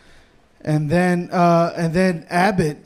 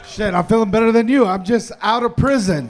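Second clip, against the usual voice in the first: ordinary delivery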